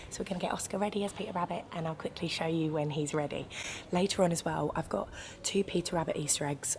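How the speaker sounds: very soft, hushed chat to camera; talking quietly. Real, not acted. Vlogger who can hear someone talking nearby, very quiet. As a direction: keep it low so nobody nearby overhears. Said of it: "I'm talking quite quietly".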